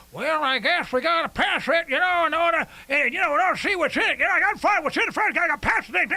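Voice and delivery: high, fast singsong mimicry of dopey compliance; mock complacent public. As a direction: imitate dim followers babbling along, loud and high. Mimics complacent public going along.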